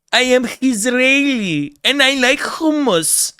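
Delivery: exaggerated fake Israeli accent, high and slow; mock Israeli accent. Parodying people faking an Israeli accent, mock Israeli accent. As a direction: ham up a put-on Israeli accent. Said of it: "fake Israeli accents".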